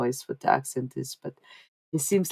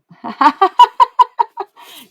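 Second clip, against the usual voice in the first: loud, high burst of laughter; real high-pitched laugh, very loud; big high cackling laugh; 14 dB louder than usual. Real, not acted. Laughing at her own remarks.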